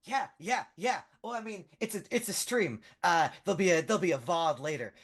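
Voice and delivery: high, excitable, stumbling voice; eager young fan. Acted. Fan offers his footage to the hero, eagerly. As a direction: babble helpfully in a high voice.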